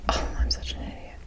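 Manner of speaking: ordinary delivery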